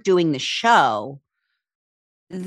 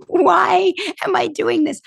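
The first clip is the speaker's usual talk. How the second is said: high, incredulous self-questioning; exasperated self-doubt; 11 semitones higher than usual. Retold or imitated. Kelly retelling her pre-tour panic, high and incredulous. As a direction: squeak it out in disbelief. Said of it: "I was just like".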